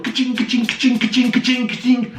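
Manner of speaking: mocking ka-ching till sound; ka-ching noises. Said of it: "they'll all be going".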